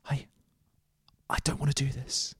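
breathless, terrified whisper; frightened whisper. Acted. Cabin boy whispers his fear to the player character. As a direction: whisper it, too scared to go on.

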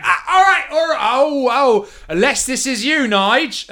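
high, wary London thug, loud; nervous thug caught at knifepoint; collared ruffian pleading with the captor. Acted. GM voices ruffian NPC.

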